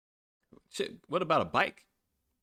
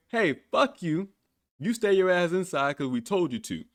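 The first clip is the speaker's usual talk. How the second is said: mock bossy government; 6 semitones higher than usual. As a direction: order them about as a mock authority. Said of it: "governments across the world saying".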